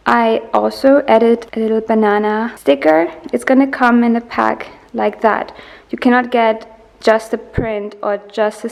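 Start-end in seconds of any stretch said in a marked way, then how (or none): none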